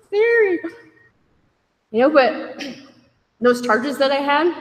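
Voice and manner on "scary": much higher, louder, rushed panicky whine; panicked refusal. Retold or imitated. Asked to chair a meeting, panicked high whine. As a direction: protest in a frightened rush. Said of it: "I'm like".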